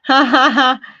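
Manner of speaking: cackling evil laugh; sinister villain cackle; wicked laugh. Acted. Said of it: "Wicked laugh".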